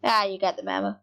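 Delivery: normal talking voice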